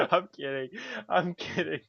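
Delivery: talking through laughter; laughing admission of a joke. Real, not acted. Laughs off own joke about mom.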